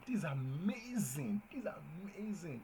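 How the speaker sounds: slow, drawn-out delight; exclamatory. Shown as a demonstration. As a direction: savour how amazing it is. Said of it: "another example".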